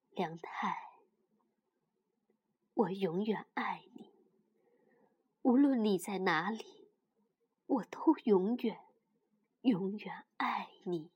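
soft, low, grieving; a mother's hushed heartbroken goodbye; sad whisper. Acted. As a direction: whisper it like a farewell. Mother grieving her lost child.

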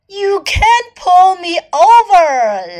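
high, loud, drawn-out scoff; hippo's hammed-up storybook jeer; mock laughing taunt. A narrator's reading. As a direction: scoff loudly at the challenger, stretching the words. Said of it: "laughed Hippo".

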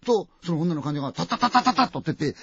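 ordinary delivery, nothing marked

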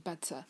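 "butter" ending in a plain uh, r left silent; British schwa ending. Shown as a demonstration. Teacher shows the British r as uh, non-rhotic "butter". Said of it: "这个R发的就是呃的音".

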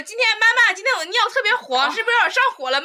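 high, loud, over-earnest report; mock child reporting to mum. Friend mocking pal's health report to mum, high childlike earnestness. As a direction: imitate someone telling mum every detail. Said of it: "听他是怎么跟他妈汇报".

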